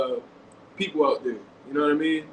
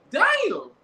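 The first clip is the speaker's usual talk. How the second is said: high, shocked exclamation; stunned damn. Retold or imitated. Vlogger recalling watching the slap live, shocked and high. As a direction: let it jump up in disbelief. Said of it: "I'm like".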